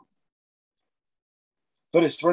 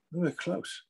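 muttered under breath, much softer; thought to himself; 3 semitones higher, 8 dB quieter than usual. Retold or imitated. Coach thinking to himself about gym boasts, said softly. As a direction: keep it as a private thought, barely voiced. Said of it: "I'm thinking to myself".

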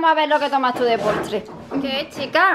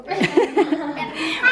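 laughter; 3 semitones higher, 4 dB quieter than usual. Real, not acted. Laughing at her own joke.

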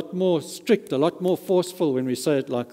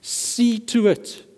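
"See" lifted high and drawn out slowly; slow, weighty command on "See"; emphatic See to it; 8 semitones higher, much slower than usual. Shown as a demonstration. Preacher showing how Jesus says it, slow and emphatic. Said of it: "He is really saying it like this".

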